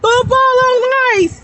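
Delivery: high shouted rallying cry; excited kid yelling out a game; yelled call. Retold or imitated. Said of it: "he would yell out".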